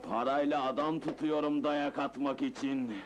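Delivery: low-pitched, relieved composure; calm, self-assured. Acted. Boss justifying paid beatings, calm and relieved. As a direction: settle back, untroubled.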